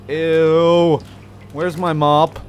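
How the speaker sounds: loud disgusted ew; yelled ew, where's my mop; grossed-out yell. Real, not acted. Grossed out by mess.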